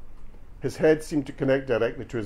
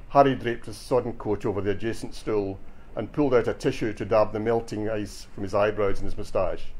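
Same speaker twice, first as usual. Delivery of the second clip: usual speaking voice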